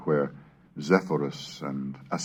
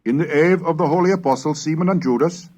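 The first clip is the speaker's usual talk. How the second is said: Middle English recitation; 4 semitones higher than usual. Acted. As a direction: intone it like a medieval scribe reading his Pater Noster. Reads a Middle English prayer aloud.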